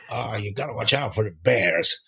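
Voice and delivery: a light imitation of a backwoods New Brunswick accent. Old guide warning a greenhorn about bears, in a light New Brunswick accent. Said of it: "a very particular New Brunswick accent".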